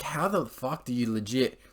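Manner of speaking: ordinary talk, nothing marked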